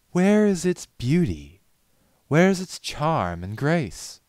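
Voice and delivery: slow, high, loud demanding questions; grand theatrical challenge; imperious demand. A narrator's reading. Rarity demands beauty.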